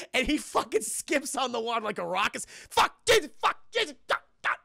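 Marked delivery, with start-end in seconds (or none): 2.7-4.6 high, loud mock panic fading out; hammed-up frantic curses; mock panicked yelps. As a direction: yelp like bouncing off water. Logan skipping on water.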